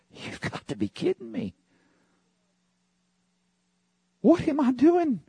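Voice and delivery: up high, amazed at himself; stunned disbelief. Retold or imitated. Preacher retelling his own shock, high and amazed. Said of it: "I'm like".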